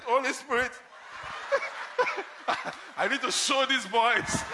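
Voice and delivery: retold angry outburst played for laughs; mock fury, laughing. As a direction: half-shout it through laughter. Retold angry reaction, half laughing.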